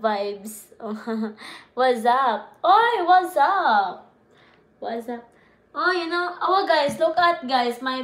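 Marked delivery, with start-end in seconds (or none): 2.6-4.1 loud, high, drawn-out call; hollered greeting. Pretend. Streamer hailing viewers, loud drawn-out holler. 4.8-5.2 soft, understated murmur. Pretend. Same greeting dropped low afterwards.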